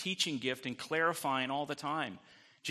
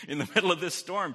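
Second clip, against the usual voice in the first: laughing; 5 semitones higher, 5 dB louder, faster than usual. Real, not acted. Laughing at Jesus' calm amid storm.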